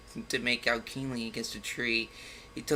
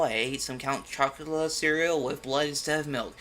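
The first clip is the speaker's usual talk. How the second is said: ordinary talk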